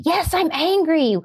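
high, drawn-out mock child protest; angry child. Preschooler answering the parent beside them, acted indignant and high. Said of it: "your kid gets angry".

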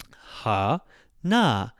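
"ha" low, pause, "na" lifted high; low then high. Shown as a demonstration. Teacher shows pitch accent, "ha" low, "na" high. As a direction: split "ha, na" into a low step and a high step. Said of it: "lower, taller".

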